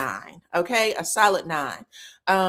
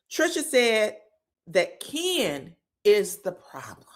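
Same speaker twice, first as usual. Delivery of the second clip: ordinary delivery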